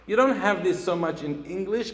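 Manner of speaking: normal talking voice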